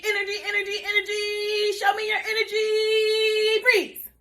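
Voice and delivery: put-on high, excited, revving the kids up; energetic hype. Shown as a demonstration. Teacher getting the class to go crazy with energy. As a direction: pump up the energy. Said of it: "go crazy with some energy".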